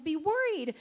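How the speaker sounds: retold rant, pitched high; high, exasperated venting; worked-up indignation. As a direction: work yourself into a lather, voice climbing. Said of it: "working myself into a lather at this point".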